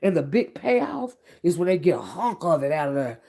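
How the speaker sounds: usual speaking voice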